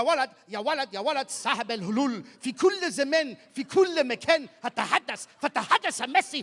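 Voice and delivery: rising hyped-up commentator chant; mock Arabic commentary.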